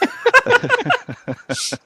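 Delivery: very high, loud burst of laughter. Real, not acted. Laughing after first words live.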